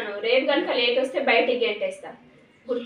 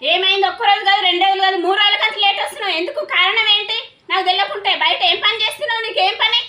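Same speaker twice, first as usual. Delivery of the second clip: loud, high-pitched parental telling-off; angry scolding; 6 semitones higher, 9 dB louder than usual. Acted. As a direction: fire questions angrily at your late-coming daughter. Parent scolds daughter for coming late. (కోపంగా గట్టిగా నిలదీయడం)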